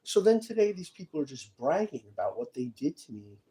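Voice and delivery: ordinary delivery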